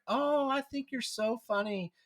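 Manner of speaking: high, slow gushing praise; gushing fan voice. Retold or imitated. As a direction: gush like an eager fan. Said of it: "they were like".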